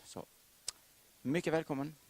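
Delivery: ordinary talk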